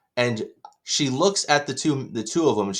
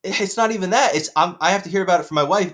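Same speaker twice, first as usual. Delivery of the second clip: exasperated; 9 semitones higher, 5 dB louder than usual. Real, not acted. Exasperated complaint.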